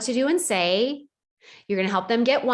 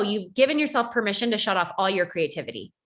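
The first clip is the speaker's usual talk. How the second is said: ordinary talk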